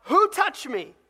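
preacher acting Jesus shouting to the crowd. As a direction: call Who out over the whole crowd. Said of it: "Jesus shouts out".

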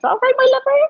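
high, loud Gloucestershire greeting; Gloucestershire accent. Shown as a demonstration. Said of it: "it's kind of that Gloucestershire accent".

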